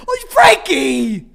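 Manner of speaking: shocked cry. Real, not acted. Friend spits beer everywhere.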